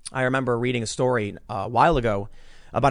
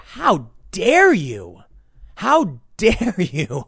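slow, loud mock outrage, stress on dare; hammed-up tearful scolding of world leaders; Greta Thunberg impression; 4 semitones higher, 4 dB louder, much slower than usual. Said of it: "channel my inner Greta Thunberg".

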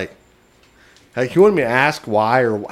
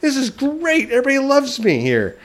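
put-on drunk boasting; 12 semitones higher than usual.

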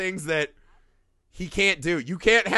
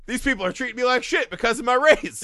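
mock Freddie Prinze Junior; 7 semitones higher, 5 dB louder than usual. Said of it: "he literally says".

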